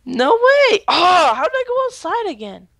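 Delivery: high, incredulous cry; disbelieving. Real, not acted. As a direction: react in total disbelief. Disbelief at losing.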